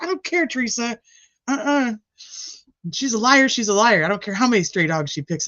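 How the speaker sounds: high, insistent mock voice, firm on liar; mock stubborn friend. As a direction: play the friend who won't hear it. Voicing Sleuthy again.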